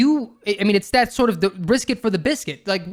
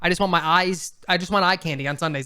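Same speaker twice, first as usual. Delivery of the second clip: ordinary talk, nothing marked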